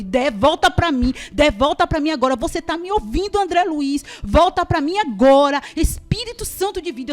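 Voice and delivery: very loud, insistent mother's plea; high, forceful reenacted command; shouted prayer. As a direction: shout as if calling the child back. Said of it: "e a gritar dessa forma".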